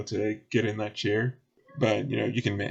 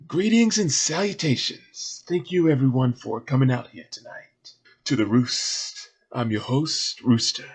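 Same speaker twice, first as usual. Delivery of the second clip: projecting stage host; 4 semitones higher, 4 dB louder than usual. Acted. Rooster addressing the crowd from the stage, projecting showman. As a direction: project to the crowd like a club emcee. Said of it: "he uses to project his voice".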